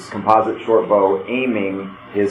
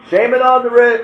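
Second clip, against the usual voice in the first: high, raised warning cry; yelling; 13 semitones higher, 4 dB louder than usual. Acted. Game master voices character warning of shaman, yelling. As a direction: call it out as a battlefield warning. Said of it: "you hear Bazor yelling".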